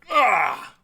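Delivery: loud, high "ah" of attack; monster lunge cry. Acted. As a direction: burst out like a skeleton lunging at its prey. GM voices the skeleton lunging.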